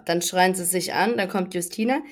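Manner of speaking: usual speaking voice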